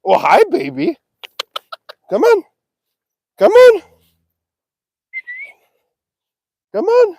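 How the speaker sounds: high ringing come-on; calling the cows. Real, not acted. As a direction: coax the calf along.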